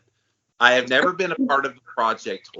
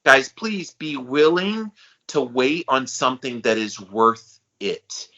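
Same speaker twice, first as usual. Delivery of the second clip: normal talking voice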